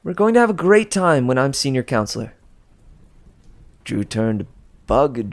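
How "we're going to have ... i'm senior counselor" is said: higher, louder, playful boast; cheery announcement. Acted. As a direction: rub it in with a bright smile. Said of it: "Piper announced".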